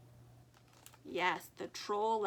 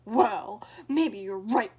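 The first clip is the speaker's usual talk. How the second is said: louder gruff troll considering; growl; 12 dB louder, slower than usual. Acted. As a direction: mull it over in a troll growl. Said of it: "growled the troll".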